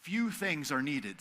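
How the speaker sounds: slow, soft and gentle; soft, near-whispered comfort; tender Jesus. Retold or imitated. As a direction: lay it down softly for them. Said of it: "Jesus says to Martha".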